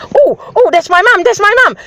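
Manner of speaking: high, excited voicing of the dog. Dog recognising its owner, high and excited. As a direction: play the dog spotting its owner, thrilled. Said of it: "he's like".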